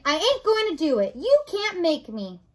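loud, high refusal; defiant. Acted. Mig refuses the rat's order, loud defiance. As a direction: dig in like a stubborn child.

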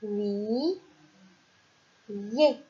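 "oui, yé" as two separate, drawn-out syllables; "oui... yé" spelled out slowly for children; syllables split apart. Shown as a demonstration. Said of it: "pour faire".

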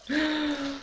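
quiet high gasp of delight; a small delighted intake of breath; soft gasp. Real, not acted. Spotting a wished-for polish.

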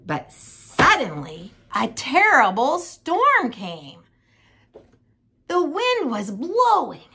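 slow, swelling into a high, urgent pitch; drawn-out, rising storytelling drama; dramatic storm narration. A narrator's reading. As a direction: make the storm burst in suddenly.

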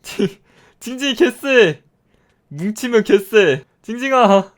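root for him hard; excited cheering. Real, not acted. Player cheering Squidward mobs in a fight, loud cheering.

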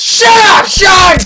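sharp high shout at a sibling; angry yell. Real, not acted. Player yelling at his brother Sean to shut up, sharp high shout. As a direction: snap at him to shut up.

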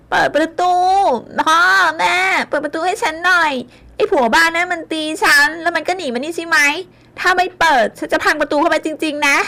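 put-on high, loud drunken yelling at the door; drunk furious yelling. A narrator's reading. Drunk daughter yelling at her parents through the door, furious. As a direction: scream at them to open up.

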